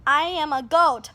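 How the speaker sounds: ordinary delivery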